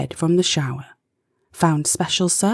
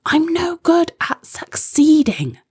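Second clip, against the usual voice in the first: frustrated cry; 11 semitones higher, 4 dB louder than usual. Acted. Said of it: "Izzy yelled".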